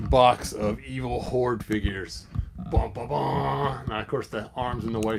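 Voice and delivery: plain reading voice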